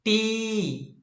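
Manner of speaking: "T" held long on a high note; lengthened syllable. Shown as a demonstration. Teacher isolating the first syllable, "T" said long and high. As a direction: stretch out "T" as a stressed syllable. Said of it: "is said or is pronounced longer".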